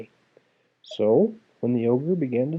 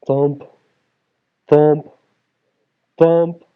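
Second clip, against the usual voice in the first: louder each thump; booming footsteps; 3 semitones higher, 8 dB louder, much slower than usual. A narrator's reading. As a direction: pound out each step like approaching giant. Ogre footsteps growing.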